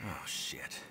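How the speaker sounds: breathless whispered oh shit; whispered dismay, barely voiced; hushed curse. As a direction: let it slip out under your breath. Sees something alarming, hushed curse.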